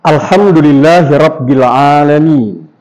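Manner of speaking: "Alhamdulillahirabbil alamin" slow, with long vowels; drawn-out "Alhamdulillahirabbil alamin"; recitation for repeating. Shown as a demonstration. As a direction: lead the class through "Alhamdulillahirabbil alamin", falling at the end. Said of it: "coba bilang semuanya".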